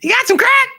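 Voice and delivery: squeaky voice-changer pitch; high-pitched voice. Acted. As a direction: crank the pitch way up. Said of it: "voice changer to make a really high-pitched voice".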